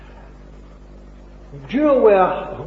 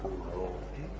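much softer and lower, almost to himself; hushed repeat; 11 semitones lower, 16 dB quieter, much faster than usual. Shown as a demonstration. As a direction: let it sink in, hushed. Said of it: "You see?".

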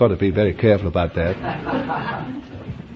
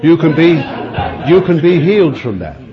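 louder and higher, firmly insisting; raised preaching voice; 5 semitones higher, 3 dB louder, faster than usual. Real, not acted. Preacher assuring a laughing congregation they can be healed, raised voice. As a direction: project it over a laughing crowd.

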